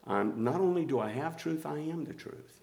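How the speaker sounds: hushed, reverent repeat. Acted. Same quote repeated softly.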